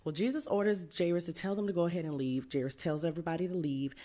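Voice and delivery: normal talking voice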